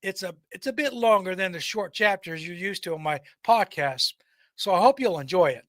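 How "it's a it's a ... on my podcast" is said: ordinary delivery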